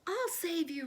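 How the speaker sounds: roaring. A narrator's reading. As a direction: uncle waking to rescue. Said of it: "roared Uncle Theodore".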